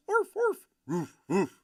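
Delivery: high yappy barks, mock dog; imitated dog barks. Streamer voicing the viewer's dogs, high mock barking. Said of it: "and the dogs".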